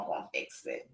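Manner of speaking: whispered under her breath. Real, not acted. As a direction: breathe it to yourself while fiddling. Repeats to herself while fixing.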